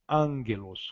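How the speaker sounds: angelos with the first g said as an N; Greek pronunciation. Shown as a demonstration. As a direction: say angelos as an-gelos. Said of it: "o gama tem o som de N". (angelos com o gama soando como N)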